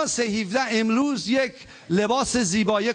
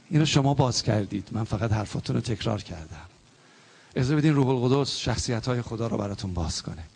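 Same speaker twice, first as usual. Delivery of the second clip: ordinary talk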